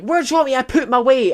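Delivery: high, loud mock shout from a rooftop; mimicked shouted complaint; imitated indignant yell. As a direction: yell it like the exasperated man in the video. Imitating man in viral video.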